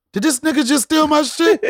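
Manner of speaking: high, loud mock outrage; indignant imagined thought. Son watching his dad steal his joke on stage, mock outrage. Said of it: "He looked at me like".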